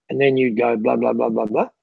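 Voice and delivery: normal talking voice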